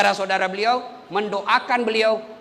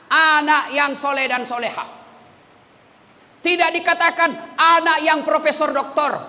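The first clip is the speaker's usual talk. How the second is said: fiery preaching; 6 semitones higher, 3 dB louder than usual. Acted. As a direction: preach it out to the crowd. Preaching at full force.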